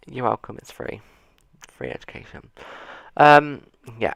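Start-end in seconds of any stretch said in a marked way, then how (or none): 0.1-2.5 sarcastic. Real, not acted. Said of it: "I'm in a really sarcastic mood today".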